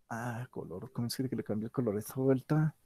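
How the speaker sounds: a quiet question to himself while clicking; muttered aside. Real, not acted. Talking to himself at the screen. (pregunta murmurada para sí mismo, mucho más suave)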